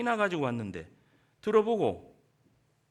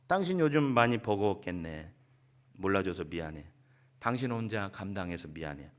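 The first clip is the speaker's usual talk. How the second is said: tender apology; 6 semitones lower than usual. Shown as a demonstration. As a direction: comfort a worn-out spouse. (지친 남편을 위로하는 아내, 낮고 부드럽게)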